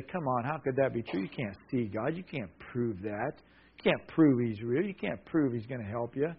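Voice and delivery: quietly scoff, questions lifting at the end; sceptical inner voice. Acted. Listeners' minds doubting the preached Word, mildly sceptical. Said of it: "and their mind would say".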